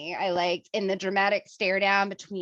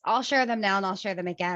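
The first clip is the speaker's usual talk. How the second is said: ordinary talk, nothing marked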